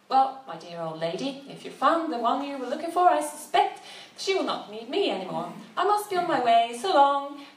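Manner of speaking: breezy, self-assured farewell; lightly voiced charming man bowing out; smooth companion.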